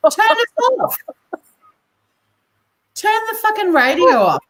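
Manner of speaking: high-pitched, exasperated yell at a caller; high, fed-up retort; exasperated mock yell. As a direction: yell it like the answer is obvious. Mock reply to complaining radio callers.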